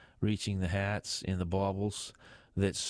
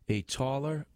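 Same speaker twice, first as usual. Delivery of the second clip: usual speaking voice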